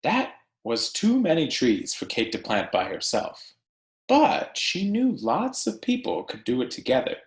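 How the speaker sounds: animated picture-book narration; reading to children. Acted. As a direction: read it to a class of kids, lively and clear. Teacher reads picture book aloud.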